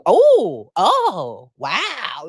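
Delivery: a loud first oh, then a dry wow; mock surprise. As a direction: imitate a pointed, amused reaction. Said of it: "she just added her commentary".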